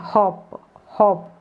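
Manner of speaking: हॉप with a short open ऑ vowel, said slowly; 1 hop said clearly. Shown as a demonstration. Teacher drilling students on the word हॉप, clear and deliberate. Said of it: "एक दो बार बोले ऑप".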